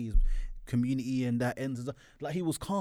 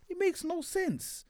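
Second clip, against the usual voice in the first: high, slow exasperation; 4 dB quieter, slower than usual. Real, not acted. Exasperated plea.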